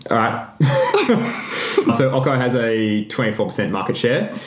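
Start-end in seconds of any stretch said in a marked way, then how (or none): 0.5-1.9 high laugh. Real, not acted. Laugh after failed accent.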